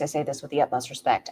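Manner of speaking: ordinary talk, nothing marked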